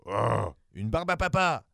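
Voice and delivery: slow, cooing monster exclamation; delighted monster. Acted. Giant monster spotting the baby, slow delighted coo. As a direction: marvel like a big beast seeing a treat. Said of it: "s'exclama-t-il".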